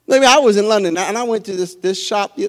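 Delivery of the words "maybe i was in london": ordinary talk, nothing marked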